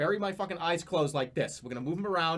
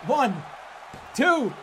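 excited count; 9 semitones higher than usual. Real, not acted. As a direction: count the pin with rising glee.

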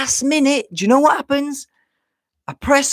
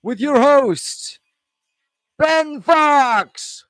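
big booming announcer intro, slow and drawn out; show announcer; 2 semitones higher, 5 dB louder, much slower than usual. Pretend. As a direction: announce yourself like a ring MC to a crowd.

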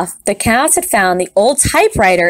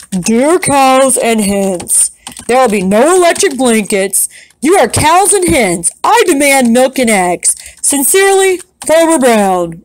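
stern farmer's letter; 2 semitones higher than usual. Acted.

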